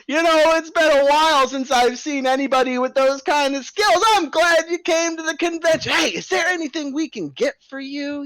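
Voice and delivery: a fast-talking circus boss buttering up the bears; ringleader voice. Acted.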